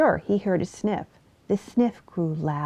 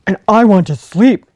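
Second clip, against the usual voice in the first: loud, gruff big-bear voice; a big bear sending the fox away, loud and grumpy; 12 dB louder than usual. Acted. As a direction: shoo her off like a cross old bear. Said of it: "said Jefferson Bear".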